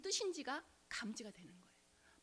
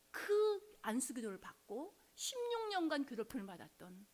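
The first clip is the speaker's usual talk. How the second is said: normal talking voice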